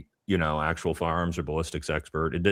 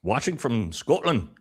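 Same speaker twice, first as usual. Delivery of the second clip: slow, higher-pitched Scottish accent; 6 semitones higher than usual. Shown as a demonstration. Host reads out a viewer's location, in a Scottish accent. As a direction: try a drawn-out Scottish accent. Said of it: "That was Scottish, bro".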